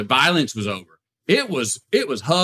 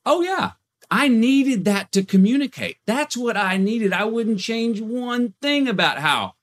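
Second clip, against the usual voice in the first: a hint of mom, pitched up and slow; lightly voicing mom, higher and drawn out; mom's voice; 10 semitones higher, slower than usual. Son voicing his mom defending her marriage, lightly higher.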